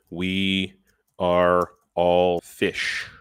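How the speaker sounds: each word drawn out very slowly; slow deliberate reading. Shown as a demonstration. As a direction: read the title out slowly, word by word. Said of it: "reads the video title out".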